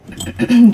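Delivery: quick throat clear. Real, not acted. Clears throat before describing tea.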